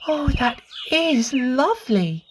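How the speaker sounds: put-on high, slow, delighted little girl; admiring little girl. A narrator's reading. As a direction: admire it slowly in a girl's voice. Lucy admiring the ironing.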